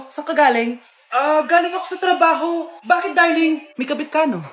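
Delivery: ordinary delivery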